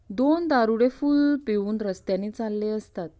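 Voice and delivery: ordinary talk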